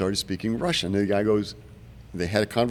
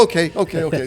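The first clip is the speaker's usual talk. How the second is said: high, loud laughing okays; 8 semitones higher, 6 dB louder, faster than usual. Retold or imitated. Imitating the team leader hearing gunfire, laughing and high. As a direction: blurt it out laughing, caught under fire.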